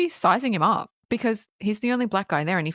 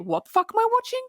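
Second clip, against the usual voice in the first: pitched way up in stunned disbelief; shocked disbelief; 14 semitones higher, much faster than usual. Retold or imitated. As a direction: squeal it like you can't believe the screen. Viewer shocked by the scene.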